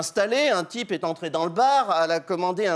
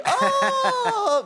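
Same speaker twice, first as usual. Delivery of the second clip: surprised ah; 4 dB louder than usual. Acted. Surprised exclamation at party.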